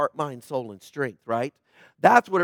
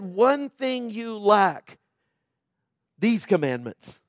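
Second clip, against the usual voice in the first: slow, drawn-out mock line; deliberately slowed mock quote; wrong version; slower than usual. Shown as a demonstration. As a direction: drag it out as the wrong reading. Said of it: "He didn't say it like that".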